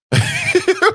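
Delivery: loud high-pitched laugh. Real, not acted. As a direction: burst into a shrill laugh. Laugh after joke.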